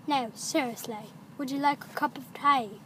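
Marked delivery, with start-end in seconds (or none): 1.4-2.8 quicker mock British accent; fast, exaggerated British vowels. Shown as a demonstration. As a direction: toss it off in a put-on British voice. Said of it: "This is how British people talk".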